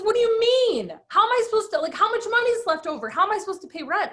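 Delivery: squeaky, frantic husband losing it over rent; mock panicked husband. Husband fretting to wife about rent, high frantic imitation.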